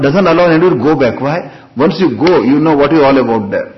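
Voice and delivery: ordinary talk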